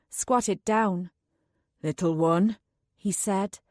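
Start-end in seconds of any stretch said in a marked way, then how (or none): 1.8-2.6 low, gentle man's voice; gentler tone. A narrator's reading. Anulan gently calls the spirit child forward, low and tender. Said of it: "gave way to a gentler one".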